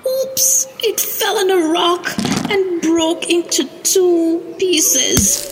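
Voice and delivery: high-pitched dismayed storyteller gasp; gasp, then tell the mishap in a high, alarmed voice; startled oops. A narrator's reading. Storyteller telling children the toy truck broke, high and dismayed.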